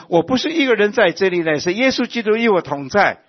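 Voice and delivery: high, loud proclamation with stress on 一; emphatic declaration. Acted. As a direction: declare it to the whole church, hitting 一 hard. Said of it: "今天我们都能够说".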